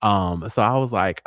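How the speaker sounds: ordinary talk, nothing marked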